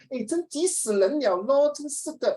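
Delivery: high whiny Malaysian Mandarin; sing-song Malaysian tonality; Malaysian Chinese accent. Retold or imitated. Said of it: "The tonality".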